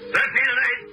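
high-pitched holler. Acted. News photographer startles the mayor mid-ceremony, hollered. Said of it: "I holler out".

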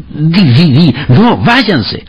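high, hurried prompting; feed the line insistently; urgent prompter. A narrator's reading. Prompter hissing the line to silent Wally, high and urgent.

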